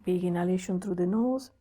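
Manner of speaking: calm, paced breathing instructions; guided breathwork. Acted. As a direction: coach the breath step by step, unhurried. Leads a breathing exercise.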